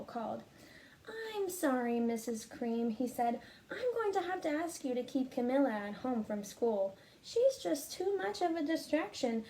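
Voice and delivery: put-on lively, expressive reading to children; storybook read-aloud. Teacher reading a picture book to her students, expressive storytelling. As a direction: read it like story time for the class.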